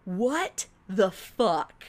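very slow, drawn-out mock fury; acted stunned indignation; incredulous outrage. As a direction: stretch each word in disbelief. Acting outrage on seeing friends.

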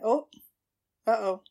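ordinary talk

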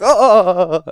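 sudden high loud yelp of fright; startled yelp. Acted. Startled by silverware crashing behind them, a loud high yelp. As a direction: jump at a crash with a sharp cry.

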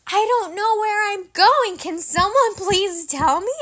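loud, high exasperated plea, acted out; frustrated outburst. Daughter demanding her parents tell her the destination, frustrated. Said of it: "I was getting frustrated".

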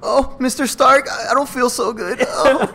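high, slow imitation of a scared kid; hammed-up young Spider-Man feeling faint; frightened child. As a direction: whimper it in a put-on child voice. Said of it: "good job acting like a child".

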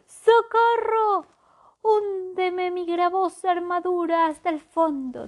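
drowning knight's shrieking plea; crying for help. Acted. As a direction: scream as the armour drags you down.

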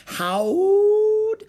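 long, high, loud howl on the word; wolf howl. A narrator's reading. Pirates celebrating like wolves, narrated as a howl.

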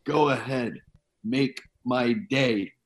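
slow, softened tough-guy catchphrase; measured movie quote, each word set apart; Dirty Harry. Retold or imitated. Reviewer quotes Clint Eastwood's famous line, Dirty Harry.

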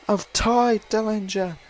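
usual speaking voice

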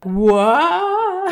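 loud, high, shocked what; surprised. Real, not acted. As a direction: gasp out the word in shock. Shocked by clip reveal.

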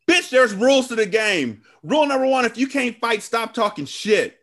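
high, loud trash talk; trash-talking thug. Retold or imitated. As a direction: swagger and taunt. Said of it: "cannot shut the fuck up".

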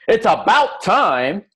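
high, drawn-out exasperated outburst. Acted. Example of what not to say.

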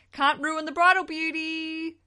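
high, loud, drawn-out playful wail; mock dismay. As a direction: exclaim it slowly in hammed-up bridal horror. Playful dismay over bridal beauty.

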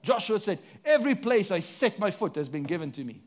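ordinary delivery

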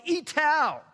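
high loud shout of Itau; joyful shouted Itau; elder's shout. Retold or imitated. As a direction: cry Itau out like a jubilant village elder. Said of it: "he yells".

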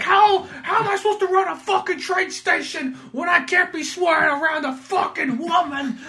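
hammed-up furious rant; mock angry New Yorker. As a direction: yell it like an outraged New York boss. Acting out angry Fat Kaz.

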